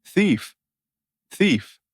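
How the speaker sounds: loud, high shout of thief. Acted. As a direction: yell it down the street. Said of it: "He shouted".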